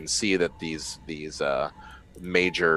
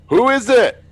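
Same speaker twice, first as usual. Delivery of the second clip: much higher, louder call through glass; shouting through the window; 14 dB louder than usual. Acted. Scared survivor shouts through the window at the crew. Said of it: "kind of shouts through the window and goes".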